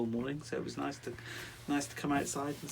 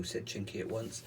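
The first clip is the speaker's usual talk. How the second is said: ordinary delivery, nothing marked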